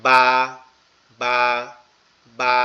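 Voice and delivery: "বা" with both lips pressed then opened; lips-together ba. Shown as a demonstration. Teacher demonstrates "বা" to online students. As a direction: close both lips firmly for "বা". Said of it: "দুই ঠোঁট একসাথে লাগবে".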